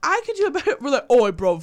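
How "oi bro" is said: mock British accent, loud burst; a hammed-up bad British bloke accent. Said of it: "bad British accent moment".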